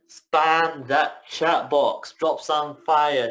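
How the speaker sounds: loud, slow, drawn-out hype; hype shout. Real, not acted. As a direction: pump up the crowd, loud and stretched. Host hyping up the chat.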